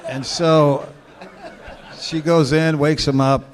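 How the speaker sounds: ordinary talk, nothing marked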